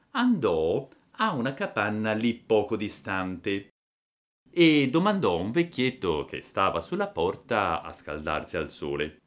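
ordinary delivery, nothing marked

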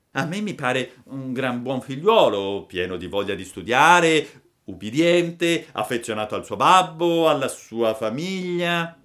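loud, high little puppet voice; brazen fibbing puppet. A narrator's reading. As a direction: praise yourself shamelessly. (burattino sfacciato, voce acuta)

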